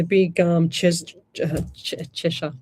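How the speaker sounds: stumbling over treasure chest, coming out as "Cheshire"; real stumble, breaking off at "Ches-"; tongue-tied. Real, not acted. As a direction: trip on the first sound of "Cheshire" and restart. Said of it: "I can't even say it".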